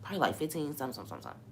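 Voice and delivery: soft, unbothered answer fading out; calm. Retold or imitated. Telling her nurse mom the time, softly and calmly. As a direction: say it like nothing's wrong. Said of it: "I'm talking like this 'cause I'm so calm".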